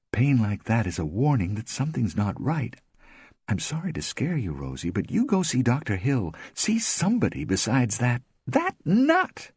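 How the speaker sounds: a hint of an anxious friend; lightly voiced worried friend. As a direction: urge her, gently alarmed. Tiger warns Rosemary.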